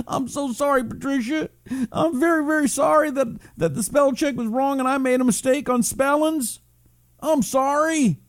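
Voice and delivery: high, whiny fake-sorry voice; over-apologize in an exaggerated high whimper; mock apology. Host answering a viewer's spelling complaint, mock tearful apology.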